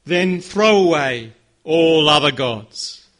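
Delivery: passionate command. Acted. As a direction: drive each word home slowly. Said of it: "I wanna hear some passion".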